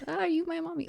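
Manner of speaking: high, soft mock-child voice; little child. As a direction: imitate a tiny kid asking timidly. Joking about her many godmothers.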